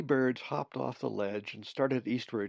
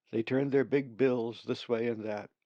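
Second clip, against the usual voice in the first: usual speaking voice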